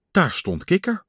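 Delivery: ordinary delivery, nothing marked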